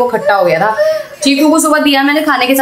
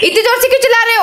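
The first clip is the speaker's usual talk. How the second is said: exclaiming; 9 semitones higher, much faster than usual. Real, not acted. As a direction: cry out much higher, taken aback. Child screeches, mother reacts loudly.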